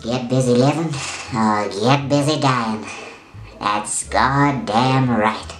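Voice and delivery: squeaky voice after sucking helium; chipmunk-like helium squeak. Real, not acted. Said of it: "as my voice gets squeaky".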